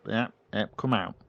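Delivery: ordinary talk, nothing marked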